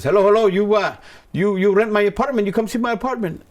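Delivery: put-on elderly Indian man, high and loud; strong Indian accent. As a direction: imitate an insistent Indian caller, pitch way up. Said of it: "he had a real strong accent".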